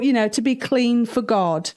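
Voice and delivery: plain reading voice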